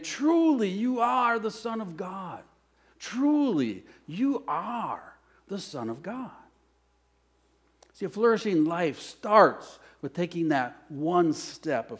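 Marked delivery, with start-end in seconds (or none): 0.0-6.3 reverent worship. Acted. Reading the disciples' worship.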